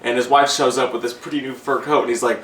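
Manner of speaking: ordinary talk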